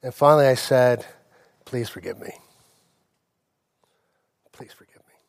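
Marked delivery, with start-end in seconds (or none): 1.6-2.4 very low, soft plea; hushed apology. Retold or imitated. Father asking his daughter's forgiveness, hushed apology. 4.5-5.1 near-silent whispered plea; whispered apology. Retold or imitated. Father repeating his apology to his daughter, whispered plea.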